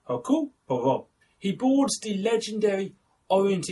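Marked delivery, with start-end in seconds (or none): 0.1-1.1 Hercule Poirot said the French way, "air-cool pwah-roh"; French pronunciation. Shown as a demonstration. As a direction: Hercule Poirot in a light French accent, no H and no final T. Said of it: "I just have to pronounce it like that".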